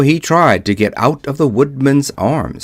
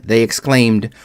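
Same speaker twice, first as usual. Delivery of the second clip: usual speaking voice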